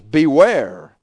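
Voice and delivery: a sudden loud "Beware", like a stern warning. Retold or imitated. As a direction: boom out the warning. Lord warning his disciples.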